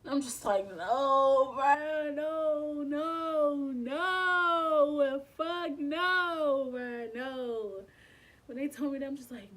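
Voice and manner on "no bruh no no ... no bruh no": loud, high wailed denial; anguished refusal. Retold or imitated. Patient told she is having a miscarriage, anguished refusal. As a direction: wail it out, easing off at the end.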